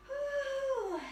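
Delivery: high, drawn-out woo; long woo. Real, not acted. Instructor after downward dog, long high woo. Whoop during yoga flow.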